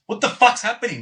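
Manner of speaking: retold shocked doctor. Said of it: "he just walks in and goes".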